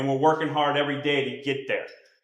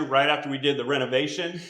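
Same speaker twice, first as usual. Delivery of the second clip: usual speaking voice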